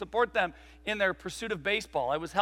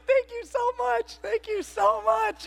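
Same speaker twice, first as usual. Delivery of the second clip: much higher, slow sugary thanks; mock polite thanks; 4 dB louder, slower than usual. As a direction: gush like a fake celebrity. Him thanking, faking it.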